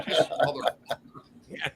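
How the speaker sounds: real laugh spilling into the words. Real, not acted. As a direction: crack up while saying it. Laughing at his own story.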